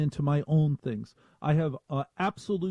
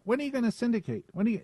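higher, whiny, pestering repeat; nagging voice; 9 semitones higher, much faster than usual. Retold or imitated. Chris Olivero nagging the host to syndicate, whiny voice. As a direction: nag him like a pest. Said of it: "somebody in that voice saying".

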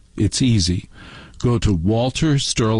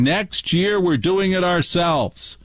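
higher, slow, emphatic vow; imitated grumbling resolve; 7 semitones higher, slower than usual. People driving back from Thanksgiving, mock-indignant resolve.

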